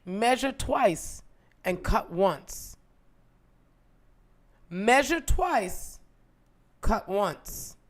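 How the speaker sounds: slow, each word weighted; slow emphatic saying. Retold or imitated. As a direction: spell out the old rule slowly. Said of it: "they'd say".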